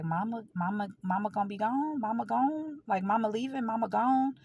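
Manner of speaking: imitated disbelief, rising and hushed; stunned questioning. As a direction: voice a brother taking in awful news. Voices brother's stunned questions about mother.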